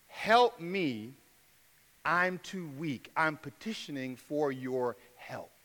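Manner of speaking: soft, slow, spent plea for help; hushed plea. Acted. Believer begging God for help, soft and fading. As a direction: let the last words fade to almost nothing. Acted prayer asking for help.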